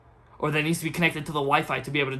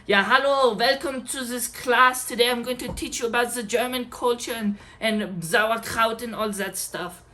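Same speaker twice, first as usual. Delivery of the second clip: higher, slow, hammed-up German accent; mock German accent; 6 semitones higher than usual. German teacher welcoming the class, mock German accent. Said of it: "German teacher and you're like".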